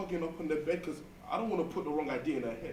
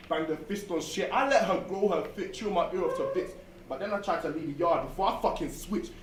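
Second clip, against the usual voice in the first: normal talking voice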